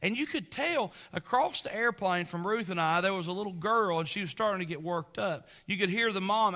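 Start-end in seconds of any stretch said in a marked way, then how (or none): none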